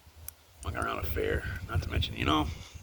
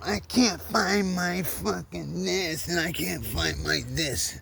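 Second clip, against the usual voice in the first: slow, higher mock complaint; imitating mother; 8 semitones higher, much slower than usual. Son mimicking his mother stomping downstairs, slow mock griping. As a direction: drag out the griping like an irritated mom. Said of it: "my mother ... stomping down the stairs going".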